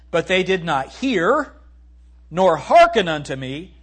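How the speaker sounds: slow, loud punch on hear and hearken; emphatic scripture. Acted. Pastor stressing the verse to his listeners, slow and loud. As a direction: lean hard on hear and hearken.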